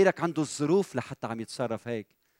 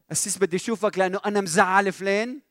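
higher-pitched questioning confession, rising at the end; owning the blame; 6 semitones higher, 3 dB louder than usual. Acted. Imagined church member phones the pastor to confess, owning the blame. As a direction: ask it as an imagined caller admitting fault. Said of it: "في حدا شي مرة بيتلفن وبيقول لك".

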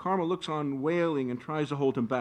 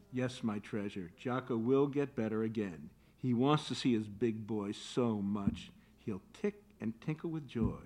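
tender; 5 semitones lower, 5 dB quieter than usual. Acted. Mother soothes unborn son.

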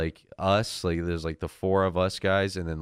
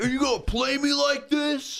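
high indignant mock challenge; hammed-up offended driver; angry driver in the street, mock high. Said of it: "And he's like".

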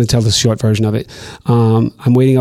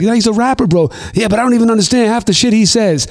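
quoted banter; 8 semitones higher than usual. Retold or imitated. Retold back-and-forth about Cardinal.

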